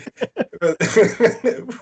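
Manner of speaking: high loud burst of laughter; big laugh. Real, not acted. Laughing before the reveal.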